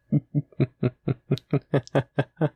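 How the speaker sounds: long, gloating demon cackle; evil laugh. Acted. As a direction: laugh like the trap just sprang. Demon lord reveals his trick.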